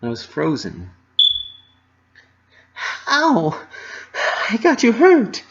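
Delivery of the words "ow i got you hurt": high, louder alarmed cry; startled guilt. A narrator's reading. Dash realizing she hurt Pinkie, alarmed.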